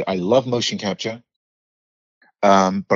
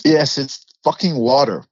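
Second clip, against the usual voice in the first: higher-pitched exasperated correction; mock exasperation; 6 semitones higher, 3 dB louder than usual. Briton repeating a word for Americans, mock exasperation. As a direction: spell it out in mock irritation. Acting exasperation at being misunderstood.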